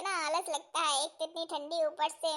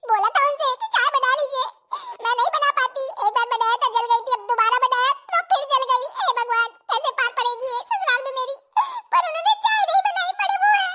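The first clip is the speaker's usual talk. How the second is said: put-on higher, louder fretting young bride; 9 semitones higher, 7 dB louder than usual. New bride alone in kitchen, fretting in high voice. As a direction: fret like a clumsy new bride.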